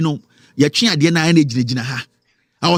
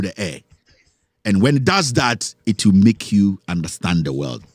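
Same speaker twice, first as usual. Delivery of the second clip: guided meditation; 4 semitones lower than usual. Acted. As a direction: draw them into calm like a guru leading a breath. Slow meditative breath guidance.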